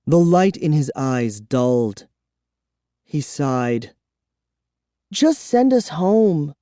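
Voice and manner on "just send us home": put-on higher, slow insistent girl. A narrator's reading. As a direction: press the demand as Kara. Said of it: "Kara insisted".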